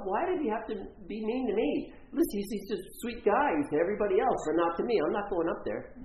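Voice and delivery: high-pitched, indignant acted griping; hurt protest. Intern refuses to join the party upstairs, hurt griping. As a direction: imitate her sulky, wounded refusal. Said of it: "her feelings are hurt".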